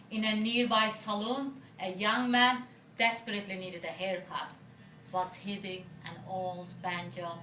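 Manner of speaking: poetry recital in short, separated phrases; poem reading. Acted. Poet telling an audience of the desert trip, phrased poem reading. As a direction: recite it as narrative verse, pausing at line breaks.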